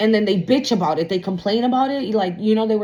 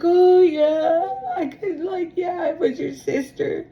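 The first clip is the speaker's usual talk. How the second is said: high, whiny voice of a parent making excuses; mock whining parent; 9 semitones higher than usual. Tarot reader mimics parent excusing themselves, high whiny. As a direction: play the parent's fake tearful excuse. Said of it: "They're gonna come in and say".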